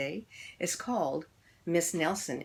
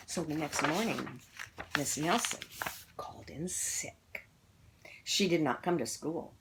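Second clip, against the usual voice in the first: ordinary delivery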